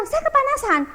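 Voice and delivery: high, loud retort in a quarrel; retold shout. Wife snapping at husband over the AC, high loud retort.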